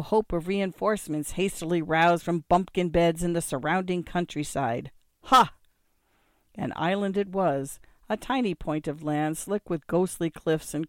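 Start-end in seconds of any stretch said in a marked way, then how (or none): 5.2-5.5 short, high, loud burst; sudden gleeful exclamation; exultant cry. A narrator's reading. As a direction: crow it in triumph. Narrator's exultant exclamation at the island.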